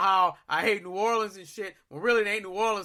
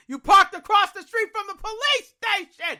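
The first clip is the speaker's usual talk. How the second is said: higher, louder, like a scolding inner voice; voice in my head; 11 semitones higher, 5 dB louder than usual. Retold or imitated. Inner voice yelling at himself after losing the car, higher and louder. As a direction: blare it like the thought finally hits. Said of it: "That's what I'm hearing in my head".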